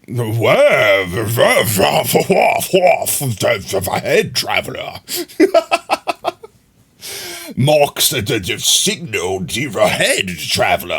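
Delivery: lightly voiced old sage; a hint of an ancient storyteller; Deckard Cain voice. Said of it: "I don't remember any of Deckard's line".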